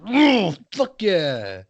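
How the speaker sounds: long rising whoop into a drawn-out yeah; drawn-out, pumped-up cheer; hyped reaction. Acted. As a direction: play up catching the good vibes, slow and stretched. Acting out catching good vibes.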